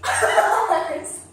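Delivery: high, loud bursting laugh on the thanks; real delighted laugh through thanks. Real, not acted. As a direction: laugh out the word. Laughs after praise.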